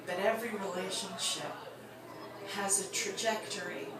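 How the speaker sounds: wrapping the story up gently; quiet reflective close. Acted. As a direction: settle into the sad moral.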